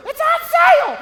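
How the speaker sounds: shrieking it's on sale in high delight; mock shopper screaming with joy; excited shout. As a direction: squeal it like a thrilled shopper. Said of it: "You're the ones going".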